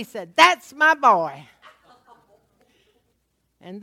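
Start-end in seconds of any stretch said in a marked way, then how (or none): 0.3-1.5 loud, slow, booming praise in a changed voice; big slow relieved pride; proud dad. Retold or imitated. Father praising son for shooting the dog, loud and slow.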